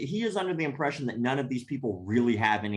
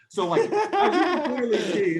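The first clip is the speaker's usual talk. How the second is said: laughing through words; 11 semitones higher, 6 dB louder than usual. Real, not acted. Laughing retelling the monologue.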